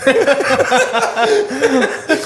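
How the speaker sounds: high, laughing dopey husband voice; mock husband. Mocking the rich husband calling to his wife, laughing. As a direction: ham up a clueless husband asking for dinner. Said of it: "husband's just like".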